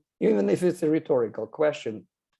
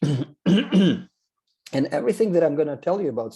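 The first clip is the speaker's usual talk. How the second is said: strained, worn-out voice; losing voice; 4 semitones higher, 5 dB louder than usual. Real, not acted. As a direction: voice giving out after clearing throat. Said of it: "sorry, I'm losing my voice".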